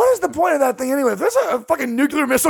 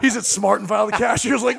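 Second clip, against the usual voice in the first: ordinary talk, nothing marked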